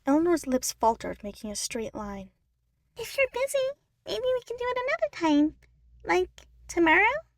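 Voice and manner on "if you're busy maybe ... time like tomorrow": much higher, hopeful little sister voice; Eleanor, pleading. Acted. Eleanor pleads to try again tomorrow, hopeful. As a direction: ask sweetly, hoping for yes.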